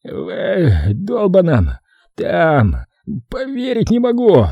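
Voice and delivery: retching between words; slow, gagging, choked words; vomiting. Acted. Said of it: "Его вырвало на другую кроссовку".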